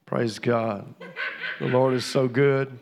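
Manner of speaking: loud praise response; preaching praise. Acted. Pastor praising God after the guest speaks, loud. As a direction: lift it up like a pastor.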